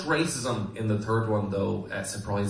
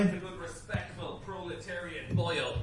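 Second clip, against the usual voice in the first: whisper; 8 semitones higher, 8 dB quieter than usual. Acted. Politician makes a hushed entrance.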